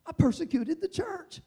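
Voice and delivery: I persecuted the church lifted high. Shown as a demonstration. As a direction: repeat it up, pleading. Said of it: "repeated with a lift".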